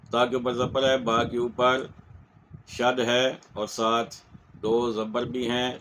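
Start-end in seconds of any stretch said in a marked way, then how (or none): none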